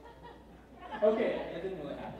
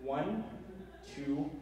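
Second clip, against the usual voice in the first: usual speaking voice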